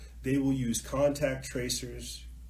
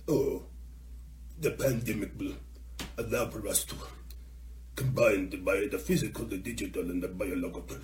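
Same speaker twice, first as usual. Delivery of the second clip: put-on shriveled old German; 5 semitones lower, 4 dB quieter than usual. Host mocking an official's pandemic plan, old German accent. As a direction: wheeze out a shriveled German accent, voice dropped low. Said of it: "put on my shriveled German, old Nazi German".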